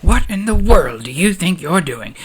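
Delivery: high, indignant outburst, acted; storming outrage. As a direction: confront him like an outraged senator. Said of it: "Mothma comes storming in".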